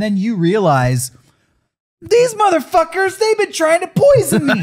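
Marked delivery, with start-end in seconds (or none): 2.0-4.6 much higher, half laughing; hammed-up betrayed guest; mock realization. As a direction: squeal it as it dawns on you. Acting Rasputin realizing poison.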